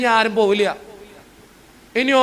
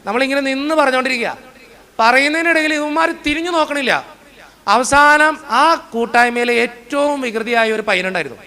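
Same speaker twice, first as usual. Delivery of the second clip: ordinary talk, nothing marked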